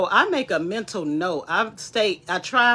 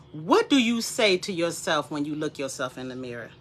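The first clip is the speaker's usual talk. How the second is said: ordinary delivery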